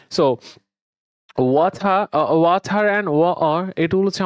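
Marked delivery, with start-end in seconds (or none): 1.4-2.1 British ওয়াটার with airy t, no final r; British accent. Shown as a demonstration. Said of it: "British accent". 2.3-3.0 British ওয়াটার with airy t, no final r; British accent. Shown as a demonstration. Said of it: "British accent".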